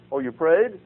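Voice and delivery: high, loud startled question; surprised. Retold or imitated. Friend surprised he prayed, high loud startled question. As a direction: caught off guard that he actually prayed. Retold chat about promised prayer.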